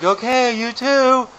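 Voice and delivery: high, loud mock cheeriness; acted embarrassment. Embarrassed skier to departing girl, high acted cheeriness. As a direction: call back with put-on brightness. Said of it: "I am incredibly embarrassed".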